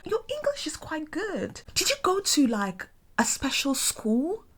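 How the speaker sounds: high, patronizing mock surprise; condescending Londoner. Imitating Londoners praising her English, high and patronizing. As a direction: mimic their fake amazement. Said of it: "Sometimes they're like".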